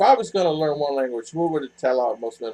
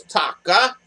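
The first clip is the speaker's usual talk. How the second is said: lightly imitated exclamation, a bit higher; imitating R R; 5 semitones higher, 3 dB louder than usual. Quoting her catchphrase to viewers, lightly imitated. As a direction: quote her word with a rising end. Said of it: "She goes".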